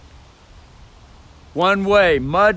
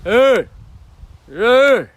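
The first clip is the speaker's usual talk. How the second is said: loud, higher "Hey!" shouts; booming lung-powered "Hey!"; loud call; 5 semitones higher, 6 dB louder than usual. Shown as a demonstration. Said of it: "down with the lungs and the bagpipes".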